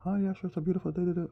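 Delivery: high, fast put-on chirp; rushed falsetto impression; mock agreeable woman. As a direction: imitate her gushing acceptance. Voices the woman accepting a walk.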